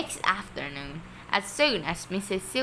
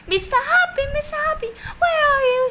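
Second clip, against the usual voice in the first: high, loud calling from below; shrill excited shout; 8 dB louder, faster than usual. A narrator's reading. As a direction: shriek his name with excitement. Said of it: "shrill with excitement".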